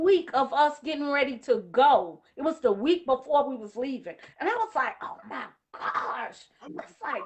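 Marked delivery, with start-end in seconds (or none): 5.0-6.5 hushed, slow, low disbelief; softly re-enacted awe; retold amazement. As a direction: take it in slowly under the voice.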